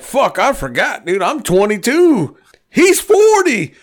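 high, drawn-out dismay; retold horrified realization. Storyteller remembers Art is much older, rising high dismay. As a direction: let it climb into a squeal of realization.

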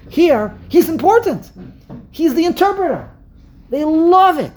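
high, loud, impassioned exclamations; emphatic excitement. Real, not acted. Speaker marvelling at the child's new importance, excited emphasis.

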